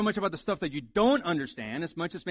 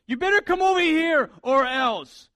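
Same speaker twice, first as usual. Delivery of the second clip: raised, high-pitched parental order; scolding parent; 10 semitones higher, 9 dB louder than usual. Acted. Parent ordering the kids over, loud stern warning. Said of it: "when you tell your, your kids".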